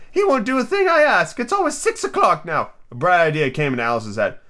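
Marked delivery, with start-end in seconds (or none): 0.1-2.7 very high, plaintive Hatter; hammed-up mournful wail. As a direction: lament it like a hard-done-by eccentric. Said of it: "went on in a mournful tone".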